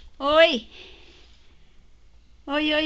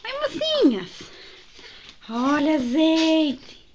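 voicing the ferret; 3 semitones higher, 4 dB louder, slower than usual.

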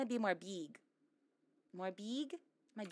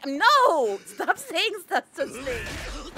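loud protest; 5 semitones higher, 5 dB louder, much slower than usual. Real, not acted. Protesting chat's teasing.

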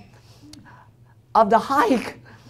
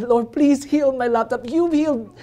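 pleading prayer; 6 semitones higher than usual. Retold or imitated. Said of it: "I came to the Lord and I said".

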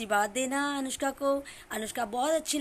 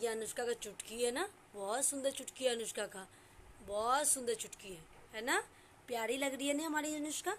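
soft fond praise; 3 semitones lower, 9 dB quieter than usual. Real, not acted. Soft praise of child's hair tuft.

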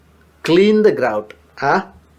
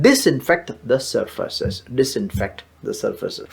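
very slow, fading softer; slow model phrase; 5 semitones lower, 6 dB quieter, much slower than usual. Shown as a demonstration. Teacher drilling the phrase slowly for learners. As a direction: draw out disinfect the surfaces, quieter the second time. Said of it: "അതൊക്കെ ഉപയോഗിച്ച് തുടയ്ക്കുക".

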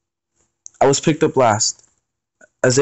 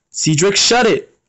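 higher, slow angry command; angry shout; 8 semitones higher, slower than usual. Acted. Aries telling Cedric to stop teasing, angry higher shout. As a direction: cut him off sharply.

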